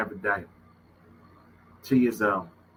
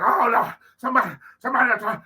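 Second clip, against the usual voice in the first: high, loud pleading for help; desperate plea; 12 semitones higher, 5 dB louder, faster than usual. Retold or imitated. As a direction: beg anyone to come. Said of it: "I'm like".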